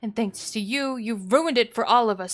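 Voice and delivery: loud scolding rant; loud angry wife scolding husband; furious mother. Acted. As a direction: berate the useless husband.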